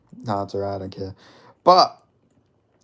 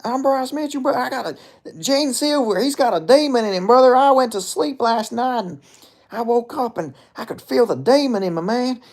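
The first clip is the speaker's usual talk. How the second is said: wailing Southern impression, pitched way up; Bryce Mitchell; 11 semitones higher, 5 dB louder than usual. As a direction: holler a tall tale in an exaggerated country twang. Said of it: "just a redneck".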